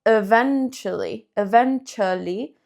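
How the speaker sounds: "eventually" said even-CHU-ally, ch sound, li ending. Shown as a demonstration. Teacher modeling "eventually" for Spanish-speaking students, clear ch sound. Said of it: "yo digo como".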